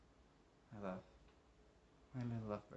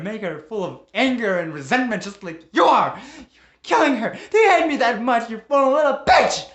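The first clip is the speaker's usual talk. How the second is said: much higher and louder screaming; husband screaming at his wife Claire; furious shouting; 10 semitones higher, 19 dB louder than usual. Acted. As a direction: scream the insults at her.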